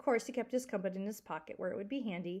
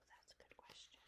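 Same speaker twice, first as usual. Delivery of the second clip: soft whisper to the class; whispered aside; 29 dB quieter than usual. Real, not acted. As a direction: lean in and whisper the comment. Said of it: "teacher's whispered aside".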